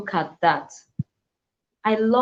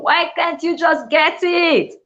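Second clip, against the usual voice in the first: high, loud, drawn-out frustrated plea; exasperated outburst; 10 semitones higher, 5 dB louder, slower than usual. Acted. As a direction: let pent-up teacher frustration burst out, acted. Acting out a frustrated teacher's outburst.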